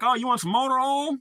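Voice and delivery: high, mock Southern store clerk; store clerk drawl. Imitating the store clerk asking about motor oil, mock Southern. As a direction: ham up a country clerk's drawl. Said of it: "they're like".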